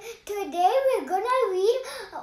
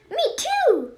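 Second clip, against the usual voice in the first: a higher, squeaky little "me too"; squeaky sidekick; 8 semitones higher than usual. Acted. Sidekick chiming in to his friend, squeaky and high. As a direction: pipe up like the tag-along kid. Second character chimes in.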